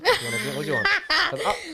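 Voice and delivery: high, laughing offer of treats; laughing indulgence. Retold or imitated. Dad laughing as he offers his daughter crisps and drinks. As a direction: laugh while spoiling the kid. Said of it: "Oh, no, that's me as well".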